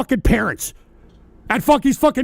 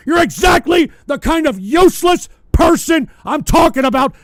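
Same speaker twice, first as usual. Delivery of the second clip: angry shouting. Real, not acted. Streamer scolding a lazy chatter, furious shout. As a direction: tear into him at full volume.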